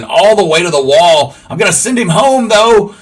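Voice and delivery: loud, high, thrilled call of the hit; excited shout. Real, not acted. Gamer calls his own hit to the wall, shouting excitedly. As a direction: cheer it on like the ball is still rolling.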